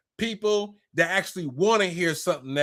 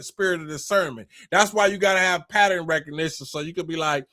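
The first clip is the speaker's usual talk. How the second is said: ordinary talk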